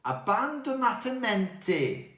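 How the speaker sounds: abbandonatamento said a-ban-do-na-ta-MEN-to; abbandonatamento, with a falling end on the last sound; Italian music term. Shown as a demonstration. Teacher repeating abbandonatamento back to the uncle. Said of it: "Now I know how to say".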